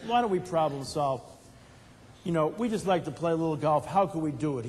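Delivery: gently smooth it over, pitched low; calm, conciliatory. Retold or imitated. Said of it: "much more calm in these kind of deals".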